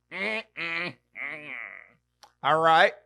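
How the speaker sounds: ordinary talk, nothing marked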